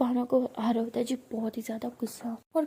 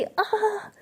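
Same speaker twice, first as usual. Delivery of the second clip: startled cry; 10 semitones higher, 5 dB louder than usual. Acted. Friend pushed onto the bed.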